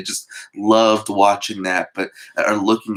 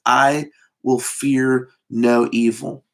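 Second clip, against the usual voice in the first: slow scripture reading; slower than usual. Shown as a demonstration. Preacher quoting the psalm slowly.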